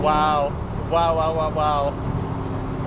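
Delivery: overwhelmed with delight; amazed wow. Real, not acted. Supercharger sound.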